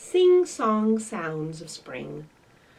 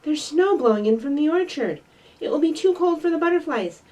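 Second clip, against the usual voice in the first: urgent; 7 semitones higher, 5 dB louder, faster than usual. Acted. Fletcher warning the rabbits.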